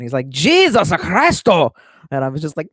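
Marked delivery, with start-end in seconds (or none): 0.3-1.7 slow, loud, higher imitation of a thick Japanese accent on Jesus Christo; mock Japanese accent. Quoting the Sonic OVA dub to chat, hammed-up Japanese accent. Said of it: "and his, like, thick Japanese accent".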